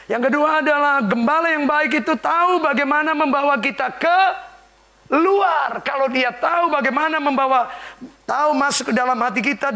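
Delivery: high, loud preaching; full-force preaching. Real, not acted. Preacher proclaiming to the congregation, loud and high.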